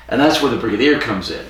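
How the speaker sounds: usual speaking voice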